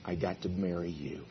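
soft, gentle thanks; tender wife. Pretend. A wife telling her husband she's grateful, soft and tender. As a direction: say it softly like you mean every word. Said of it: "If a wife would say to her husband".

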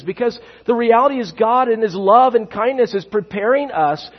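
urgent pulpit preaching; preaching at full force. Acted. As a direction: drive the point home to the congregation.

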